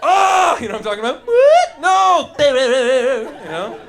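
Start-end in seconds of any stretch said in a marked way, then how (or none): 0.0-0.6 loud high yelp of being hit; a mock pained scream; car hit scream. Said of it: "know that sound you make?".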